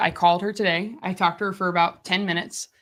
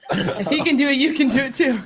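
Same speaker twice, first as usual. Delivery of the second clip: laughing while echoing his motto; 6 semitones higher, 4 dB louder than usual. Real, not acted. Cohost laughing as she repeats his line. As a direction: giggle through it, voice up high.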